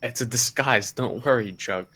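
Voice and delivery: ordinary talk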